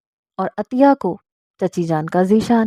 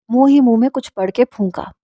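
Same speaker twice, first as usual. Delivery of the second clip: usual speaking voice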